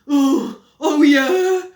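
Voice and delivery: loud defiant bluster. Acted. Henry caught out, loud blustering comeback. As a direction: bluff it out loudly. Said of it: "blustered Henry".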